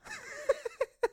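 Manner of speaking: short squealing laugh; high squeaky giggle. Real, not acted. Cohost laughing at the Yogi Bear joke, high giggle.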